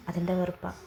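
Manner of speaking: plain reading voice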